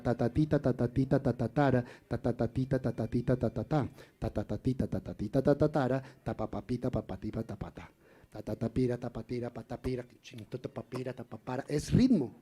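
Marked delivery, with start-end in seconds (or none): 0.0-11.4 chanted "ta ti" syllables fading softer and softer; rhythm scansion. Shown as a demonstration. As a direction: beat out the meter with syllables, dying away. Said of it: "puedes cambiar la sílaba por un ta".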